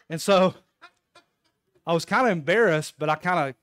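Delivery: normal talking voice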